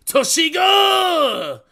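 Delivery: high, slow, loud anime shout; anime battle cry. Retold or imitated. Mocking anime characters calling attacks, loud high shout. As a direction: yell it like a manga hero attacking. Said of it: "They be like".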